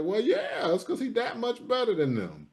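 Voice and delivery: high-pitched mock-obvious comeback; incredulous retort. Host answering praise of a small-school player, incredulous retort. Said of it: "I'm like".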